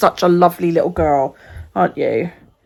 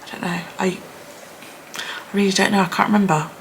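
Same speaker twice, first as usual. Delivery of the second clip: usual speaking voice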